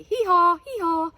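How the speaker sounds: high loud braying hee-haw; donkey hee-haw. Acted. Explaining the donkey's sound to kids, loud braying. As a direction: bray like a donkey. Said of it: "They say".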